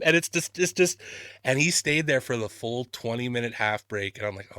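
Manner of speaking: normal talking voice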